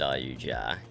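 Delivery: normal talking voice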